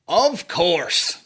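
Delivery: sarcastic. Real, not acted.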